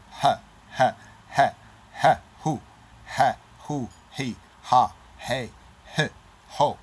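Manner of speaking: hard, short bursts of breathy H on each "ha, hu, he"; emphatic, staccato H syllables. Shown as a demonstration. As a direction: punch each "ha" out sharply, with a pause after it. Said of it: "It's a more of an emphatic ha".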